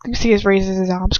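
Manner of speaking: usual speaking voice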